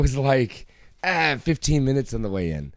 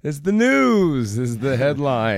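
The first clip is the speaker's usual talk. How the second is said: sarcastic; 3 semitones higher, 6 dB louder, slower than usual. Real, not acted. Mocking cohost's news reading.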